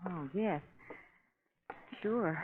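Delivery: ordinary talk, nothing marked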